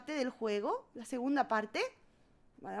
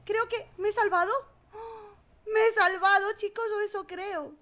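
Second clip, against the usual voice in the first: relieved; 5 semitones higher, 4 dB louder than usual. Real, not acted. Relief after escaping wolves.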